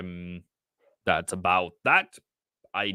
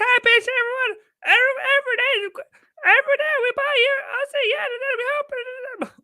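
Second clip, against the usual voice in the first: much higher, faster put-on cartoon squeal; goofy hyper streamer voice; 4 dB louder, faster than usual. Trader mocking a hyped-up stream intro in a high cartoon voice. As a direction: ham up an over-the-top stream intro. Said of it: "I could be like".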